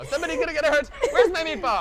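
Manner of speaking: high, loud hammed-up Italian accent; over-the-top mobster Italian accent. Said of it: "He's Italian now".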